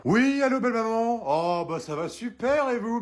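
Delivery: high, over-sweet greeting to the mother-in-law; fake sweet. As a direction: lay on false politeness, sugary. (fausse gentillesse, voix haute et mielleuse)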